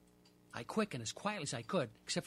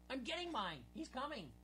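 much higher yet softer, eager; excited cry; 8 semitones higher, 7 dB quieter than usual. Acted. Portry crying out while landing his fish. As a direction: gasp it out as the fish comes up. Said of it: "Portry cried".

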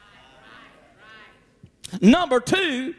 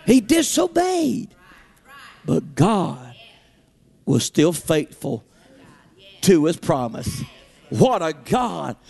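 full-force preaching. Acted. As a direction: hammer it home to the congregation. Preaching climax on God's faithfulness.

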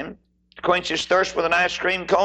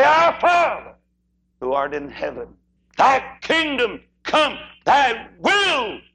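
prayer; 9 semitones higher, slower than usual. Acted. Sermon prayer quoted.

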